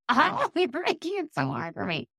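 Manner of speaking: high-pitched laugh breaking into words; laughing protest. Retold or imitated. Telling husband he makes it hard, laughing protest.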